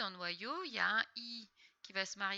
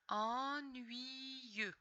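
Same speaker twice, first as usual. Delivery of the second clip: "Ennuyeux" stretched slowly, syllables joined; syllable-by-syllable reading; 3 semitones higher than usual. Shown as a demonstration. Teacher reading a word together with pupil, "Ennuyeux" drawn out. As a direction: draw out each syllable of "Ennuyeux". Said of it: "on les lit ensemble".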